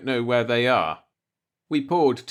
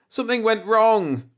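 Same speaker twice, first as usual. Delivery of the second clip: crying out, shuddering; 10 semitones higher, 4 dB louder than usual. A narrator's reading. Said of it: "he cried, shuddering".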